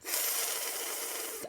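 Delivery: soft long airy th. Shown as a demonstration. Coach breathes out a long th. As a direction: push lots of air through the th. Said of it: "and lots of air".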